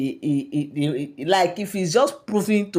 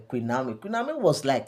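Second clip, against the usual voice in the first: ordinary delivery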